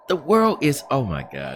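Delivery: ordinary talk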